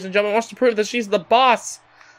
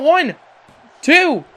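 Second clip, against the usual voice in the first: excited count; 7 semitones higher than usual. Real, not acted. Counting the pin.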